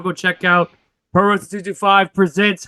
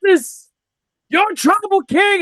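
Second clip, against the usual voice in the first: much higher announcer call; ring announcer; 13 semitones higher, slower than usual. Acted.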